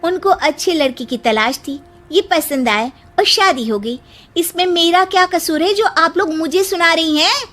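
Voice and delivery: put-on offended young daughter-in-law; indignant. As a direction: confront the gossiping aunties with hurt indignation. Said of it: "उसे बहुत गुस्सा आ जाता है".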